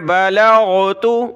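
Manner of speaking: बलगतु with a throaty gargled ghain, slow; correct ghain. Shown as a demonstration. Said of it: "क्या पढ़ना है".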